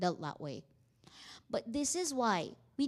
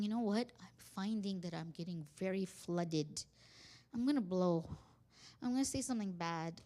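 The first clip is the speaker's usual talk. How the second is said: low, subdued confession of being overwhelmed; calm admission; 5 semitones lower, 7 dB quieter than usual. Shown as a demonstration. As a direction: own up softly before it escalates. Models calmly admitting being flooded.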